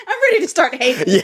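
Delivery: high-pitched, laughing through the words. Real, not acted. Eager listener joking to the book reviewer, laughing. Joking about hating the heroine.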